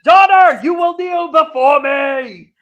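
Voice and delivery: slow, loud, high commanding shout; booming demon lord. Retold or imitated. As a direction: thunder the demand like a tyrant. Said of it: "and he says".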